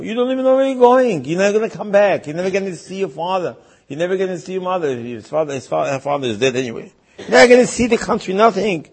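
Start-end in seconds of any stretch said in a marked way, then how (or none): 0.0-4.9 high-pitched mock parental outrage; worked-up, raised voice of protest; alarmed protest. Parents protest at girl leaving. 7.2-8.9 loud, high, fast mock outrage; acted parental outburst, loud and quick; exasperated protest. Parents protest at girl leaving.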